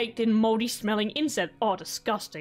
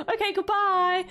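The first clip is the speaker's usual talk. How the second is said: higher, brighter quick farewell; chirpy goodbye; 8 semitones higher than usual. Acted. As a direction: toss off a cheery bye. Saying farewell to a game character.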